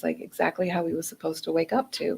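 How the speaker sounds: ordinary talk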